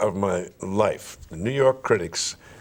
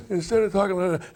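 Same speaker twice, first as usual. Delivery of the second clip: weak voice; 8 semitones higher than usual. Shown as a demonstration. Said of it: "talk like this".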